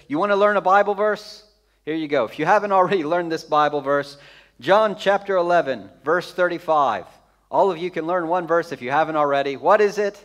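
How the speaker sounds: usual speaking voice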